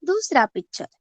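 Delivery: normal talking voice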